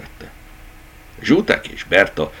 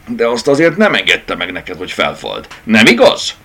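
louder, rising threat at the end; growled question; 4 semitones higher, 6 dB louder than usual. A narrator's reading. Dachshund growls at the rabbit, threatening. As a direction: growl it menacingly. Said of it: "mordult rá a nyúlra".

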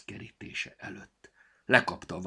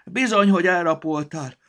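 high, loud laughing squeak; gleeful accusation; 12 semitones higher, 12 dB louder than usual. A narrator's reading. Storybook happily accusing Petya of kidnapping, high and laughing. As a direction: accuse him with delight. Book character voice.

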